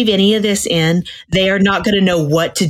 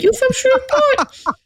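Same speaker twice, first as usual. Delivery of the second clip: much higher, squeaky cartoon Cajun firefly; firefly character voice. Quoting the movie firefly to friends in a high cartoon voice. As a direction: pop the question up high like a tiny bug.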